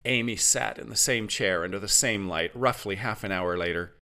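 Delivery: usual speaking voice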